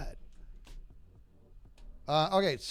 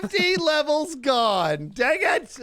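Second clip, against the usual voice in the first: anguished cry; 10 semitones higher, 8 dB louder, slower than usual. Real, not acted. Just lost fifty levels.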